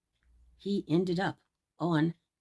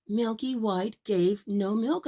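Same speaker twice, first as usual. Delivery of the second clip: ordinary delivery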